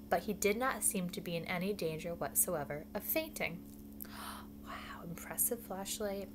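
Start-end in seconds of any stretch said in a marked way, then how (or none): 3.6-4.6 hushed, whispery gasp; soft gasp. Acted. Reacting to the little possum's climb, soft gasp. 4.6-6.3 hushed, whispered aside; whispered praise. Acted. Praising Flashlight for not fainting, whispered aside.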